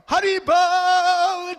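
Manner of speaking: long, high, loud holler; celebratory calling out; jubilant cry. Acted. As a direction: draw out an ecstatic shout. Acts worldwide kirtan breaking out.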